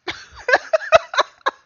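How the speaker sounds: high, loud squealing laugh; loud shrieking laugh. Real, not acted. Reacts to Von Karma appearing.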